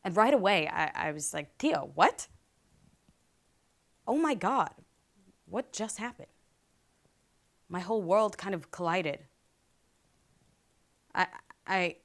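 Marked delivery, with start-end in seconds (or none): 1.6-6.3 acted shock. Girl reacting to her uncle's hands on her, shocked.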